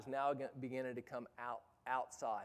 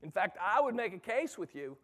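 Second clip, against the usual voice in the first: raised, higher-pitched preacher's delivery; forceful preaching; 6 semitones higher, 9 dB louder than usual. Real, not acted. Preacher to youth workers about mediocre ministry, forceful preaching. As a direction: preach it with mounting force and volume. Preaching, urging radical change.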